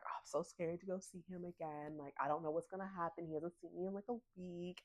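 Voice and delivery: soft anxious fretting; nervous hushed worry. Retold or imitated. Gemma nervous about seeing Caleb again, soft fretting. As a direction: fret quietly to yourself.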